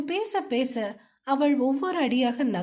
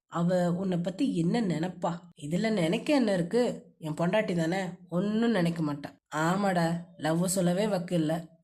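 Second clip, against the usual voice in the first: a touch lower for the hero; lightly voiced husband, a little lower; lightly voiced young man; 6 semitones lower than usual. A narrator's reading. Hero argues with himself. (லேசாக தாழ்ந்த ஆண் குரல்)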